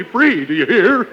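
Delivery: ordinary delivery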